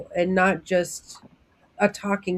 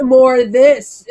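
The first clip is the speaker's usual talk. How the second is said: mock dramatic; 4 semitones higher, 13 dB louder, much slower than usual. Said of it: "I'd be like".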